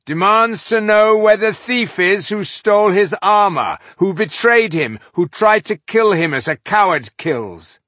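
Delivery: loud, high-pitched challenge; echoing herald's call. A narrator's reading. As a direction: proclaim the accusation to a whole army. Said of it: "so echoed the voice".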